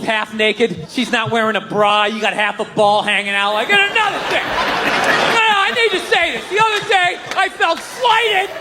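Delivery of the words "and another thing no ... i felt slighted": whiny grievance. Retold or imitated. Mocking petty relationship complaint.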